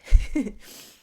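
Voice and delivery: a sudden loud breathy sob; catching a sob mid-sentence; choked sob. Real, not acted. To struggling viewers, overcome, a loud choked sob. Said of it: "I'm getting emotional".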